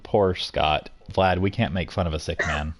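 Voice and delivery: usual speaking voice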